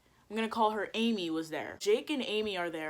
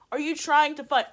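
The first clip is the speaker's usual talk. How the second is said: quick, loud, higher demand; acted-out confrontational question; retold angry challenge; 5 semitones higher, 6 dB louder than usual. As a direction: fire off the challenge indignantly. First take of retold challenge.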